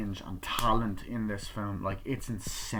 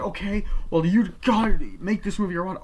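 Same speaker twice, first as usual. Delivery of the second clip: higher, louder challenge; heated retort; 10 semitones higher, 8 dB louder than usual. Retold or imitated. Father firing back at his son, raised and loud. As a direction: push back hard.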